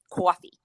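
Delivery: "coffee" said with a long rounded aw, like cawfee; New Haven coffee. Shown as a demonstration. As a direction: say "coffee" the New York way, open aw vowel. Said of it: "This is how we would say it".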